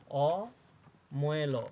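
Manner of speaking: slow spelling out. Shown as a demonstration. Teacher to pupils, drawing অময়ল out very slowly. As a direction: say অময়ল very slowly, each part drawn out. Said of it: "এরপরে".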